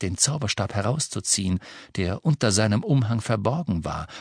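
ordinary delivery, nothing marked

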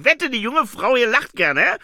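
loud, high, pushy character voice; Herr Windler. Acted. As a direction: nudge her loudly for a laugh. Pushy visitor teases the woman. (laut, hoch und aufdringlich)

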